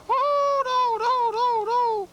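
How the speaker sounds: very high, frightened little egg voice; panicky egg voice. Acted. Egg begs the bear not to push him over, very high and panicky. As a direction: squeal and beg not to be tipped over.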